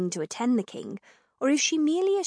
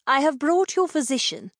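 higher-pitched woman's line, lightly acted; Althea's formal announcement; 6 semitones higher, 4 dB louder than usual. A narrator's reading. Althea presenting the physician to the king, formal announcement.

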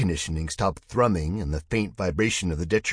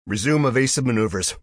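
commanding; 5 semitones higher, 7 dB louder than usual. Acted. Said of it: "Duggan commanded".

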